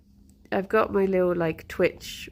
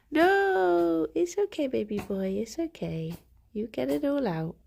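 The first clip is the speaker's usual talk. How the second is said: soothing pet talk; 7 semitones higher than usual. Real, not acted. Streamer soothing her sneezing pet, high and tender.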